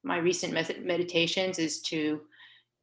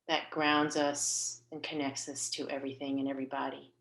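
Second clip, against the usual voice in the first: guided meditation. Real, not acted. Leader guiding listeners to picture their soul's path, calm guided meditation. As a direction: guide them gently inward, leaving space between phrases.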